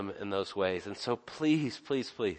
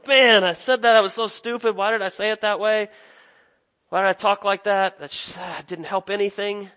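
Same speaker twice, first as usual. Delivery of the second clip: higher and louder, berating yourself; self-reproach; 9 semitones higher, 8 dB louder than usual. Retold or imitated. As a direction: replay the regret out loud, kicking yourself. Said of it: "you lay at night and go".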